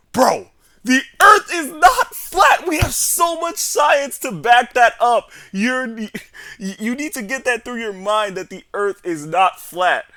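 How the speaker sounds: loud, high mock yelling; drawn-out, hammed-up outraged lecture; exasperated teacher. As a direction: imitate a fed-up teacher shouting the facts.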